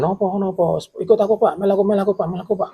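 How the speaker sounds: high, urgent begging to follow; frightened guard urging his colleague to come upstairs, high and urgent; panicked pleading. Retold or imitated. Panicked friend pulling him upstairs.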